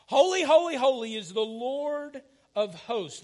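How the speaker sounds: loud, high cry of praise; exultant proclamation. Acted. Seraphim calling to one another in worship, exultant proclamation. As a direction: proclaim it loud, stretching out the final words.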